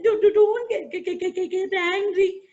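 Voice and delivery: a tiny mouse begging with chattering fear; frightened mouse pleading with the lion; stammering scared mouse. Acted.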